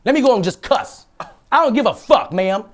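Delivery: indignant, high-pitched rant; heated cussing. Retold or imitated. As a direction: let loose and cuss her out. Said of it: "Now I'm cussing".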